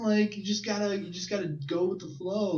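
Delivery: hippie cop. Retold or imitated. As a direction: play it like a chilled-out hippie cop. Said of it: "kind of like a hippie cop".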